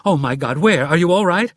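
high, loud alarm; frantic questions from a shocked wife; screamed. A narrator's reading. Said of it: "screamed Anna".

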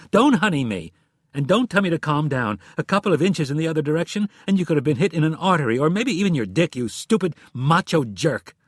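a wife's rising fury at her reckless husband; angry tirade. Acted. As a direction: tear into him, words tumbling out fast. Furious wife scolds injured husband.

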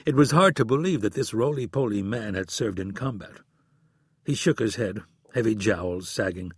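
ordinary delivery, nothing marked

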